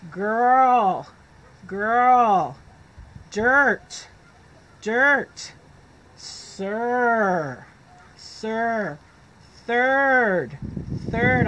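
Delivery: each word said alone, loud and falling; slow drill words. Shown as a demonstration. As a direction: call out each word for the class to echo. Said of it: "Say it with me".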